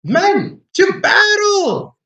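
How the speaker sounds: high, loud rallying cry to the crew; captain rallying his sailors against zombies; battle cry. Acted. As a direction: rouse the men to fight, building to a yell. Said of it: "he starts saying".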